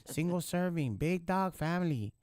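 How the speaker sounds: high-pitched caricature of a foreign inmate; put-on high voice, listing like a menu; mimicking inmate. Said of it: "I'm gonna mimic".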